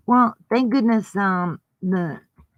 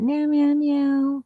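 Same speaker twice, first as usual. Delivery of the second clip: meowing; 6 semitones higher, slower than usual. Pretend. Playful cat meows at Pussycat.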